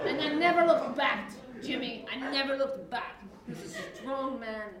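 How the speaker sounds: lightly voiced old-country grandma; a hint of a blunt, bossy granny; foul mouthed granny. Grandma tells how grandfather proposed.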